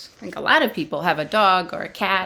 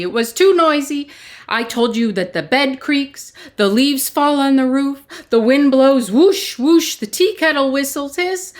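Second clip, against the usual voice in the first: louder, higher, fast angry rant; angry complaint; 7 semitones higher, 5 dB louder than usual. Acted. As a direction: storm in and blame the wise man. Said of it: "Peter was angry now".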